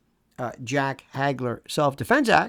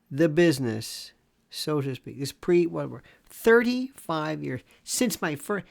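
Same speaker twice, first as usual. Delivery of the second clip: normal talking voice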